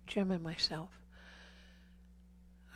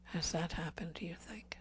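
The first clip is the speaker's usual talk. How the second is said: intimate preaching. Acted. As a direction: preach close to the congregation, dropping to a breath. Preaching to men about abortion.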